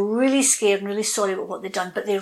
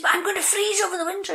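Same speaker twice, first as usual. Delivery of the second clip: much higher, fast whining plea; an old tree begging for mercy; desperate plea; 11 semitones higher, faster than usual. Acted. The oak tree begging the north wind, high and fast.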